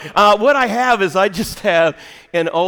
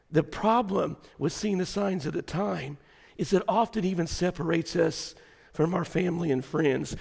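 much softer, intimate sermon delivery; quiet preaching; 8 dB quieter than usual. Acted. Preacher reading Jesus' words to the church, soft intimate preaching. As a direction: pull them in close and quiet.

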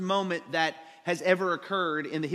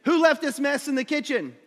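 high, loud imitated parent demanding an answer; mock parental scolding; 7 semitones higher, 6 dB louder than usual. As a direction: call out like an annoyed parent. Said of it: "my parents would say".

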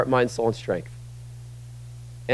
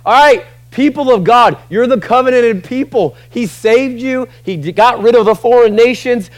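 high, loud leader addressing a crowd; rallying challenge; 7 semitones higher, 5 dB louder than usual. Acted. Joshua challenging the people of God, loud and rallying. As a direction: call out to the whole nation.

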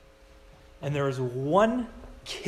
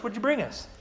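ordinary delivery

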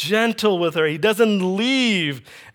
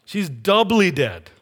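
ordinary talk

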